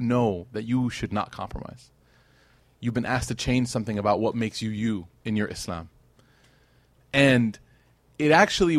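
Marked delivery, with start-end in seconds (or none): none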